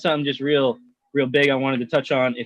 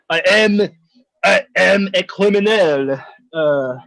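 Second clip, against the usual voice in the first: higher, louder hammed-up French criminal; mock French accent; 4 semitones higher, 4 dB louder than usual. Prisoner bribing his way out, hammed-up French accent. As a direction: play a French crook offering a bribe, hammed up. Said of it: "how to do the French accent".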